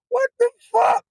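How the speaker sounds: high incredulous outburst; shocked. Retold or imitated. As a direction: shriek it out in disbelief. Driver reacting to hit on.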